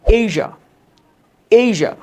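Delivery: "এশিয়া" as AY-zhuh, high and loud; loud, falling AY-zhuh for "এশিয়া"; correct zh pronunciation. Shown as a demonstration. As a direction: punch "এশিয়া" in two syllables with zh. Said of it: "উচ্চারণটা একটু different".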